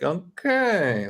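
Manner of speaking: high, drawn-out okay; high incredulous okay; baffled okay. Retold or imitated. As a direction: stretch it out in disbelief. Baffled reaction to clerk's rudeness.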